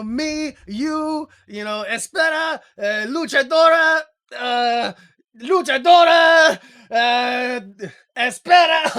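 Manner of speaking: loud, high promo with stalling fillers; halting foreign-language promo. Acted. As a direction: shout stray words like a struggling promo. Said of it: "it'd be like me going".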